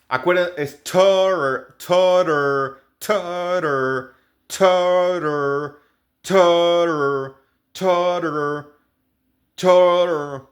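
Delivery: "totter" with the middle tt as a Spanish r; flapped T. Shown as a demonstration. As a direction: say "totter" as "tor-er". ("totter" con la doble T como una R nuestra)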